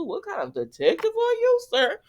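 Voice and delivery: high, mocking question; mocking a detective; sassy mockery. Real, not acted. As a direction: tease him with a high put-on voice. Mocking Dante's detective claim.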